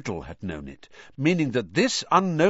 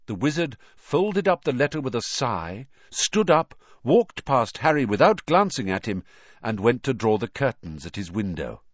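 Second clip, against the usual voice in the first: ordinary delivery, nothing marked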